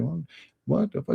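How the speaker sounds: high-pitched shocked what the fuck; imitating his own stunned reaction, voice jumping up; retold disbelief. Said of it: "I was like".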